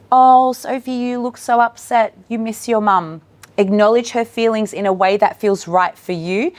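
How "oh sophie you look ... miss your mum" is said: higher, louder caring opener; acknowledging. Shown as a demonstration. Educator greeting distressed Sophie at drop-off, caring. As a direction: reach out to the crying toddler. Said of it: "Write down what you would say".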